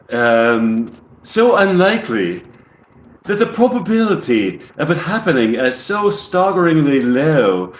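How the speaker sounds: put-on English accent, explaining with deliberate emphasis; alien with English accent. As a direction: imitate an English alien lecturing on a marvel. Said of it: "speak like an alien with an English accent".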